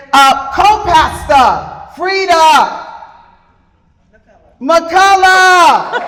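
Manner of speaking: slow, high, loud stretched-out name call; host calling out the co-pastor's name to cheers; drawn-out hype intro. Real, not acted.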